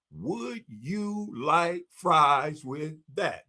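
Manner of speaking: very slow, counting each word out; slow counting delivery. Shown as a demonstration. Speaker to audience, slowly counting the words. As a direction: space the words to count them. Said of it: "Let's see if there's something wrong with my math".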